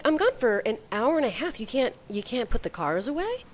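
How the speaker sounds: high-pitched exasperated retold complaint; annoyed. Wife back from the gym to husband about uncleared toys, annoyed. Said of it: "Like, immediately annoyed".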